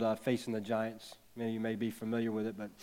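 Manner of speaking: ordinary talk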